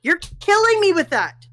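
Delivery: high pleading complaint; exasperated, begging to stop; pained protest. Real, not acted. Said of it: "pained protest".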